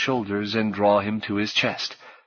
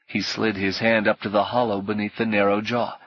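plain reading voice

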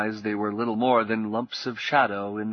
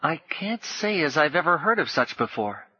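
put-on puzzled boy; 6 semitones higher than usual. A narrator's reading. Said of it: "screwed up his forehead".

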